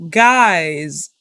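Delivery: normal talking voice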